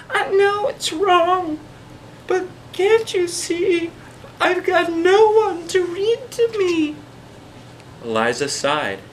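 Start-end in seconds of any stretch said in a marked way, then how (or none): 0.1-6.9 very high, slow, sad little voice; tearful creature. A narrator's reading. Snatch-a-Book to Eliza, high tearful plea. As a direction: plead through tears. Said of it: "softly he began to cry".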